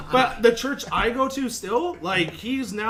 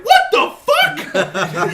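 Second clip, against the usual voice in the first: very high, louder, very slow and laughing; exaggerated disbelief; 9 dB louder, much slower than usual. Retelling his reaction at church: very high, slow, laughing disbelief. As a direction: drag out the shock for the laugh. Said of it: "I'm like".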